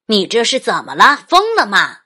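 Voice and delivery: loud scolding shout; angry dad. Acted. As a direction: shout at the runaway child. Dad scolds runaway child.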